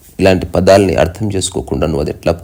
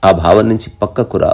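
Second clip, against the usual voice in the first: normal talking voice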